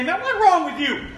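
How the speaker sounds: shepherd scolding the stray sheep, high and exasperated; mock scolding shepherd. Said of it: "He does not come to that sheep and say".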